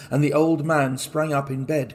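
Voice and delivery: plain reading voice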